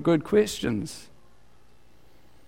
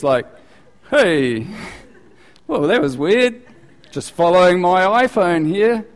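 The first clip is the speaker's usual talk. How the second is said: high, louder airhead voice, pleased with himself; mock clueless driver; 4 semitones higher, 4 dB louder than usual. Preacher mocks a driver following his phone onto the runway, higher and louder. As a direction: play the driver cheerfully trusting his phone.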